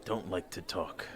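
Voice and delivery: low, soft and slow reluctant grunt; taciturn big guy. Acted. Big companion grumbling to the player, low and slow. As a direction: mutter it like a man who hates talking. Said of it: "He goes".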